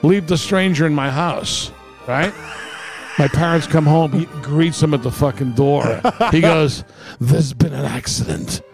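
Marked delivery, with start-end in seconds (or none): none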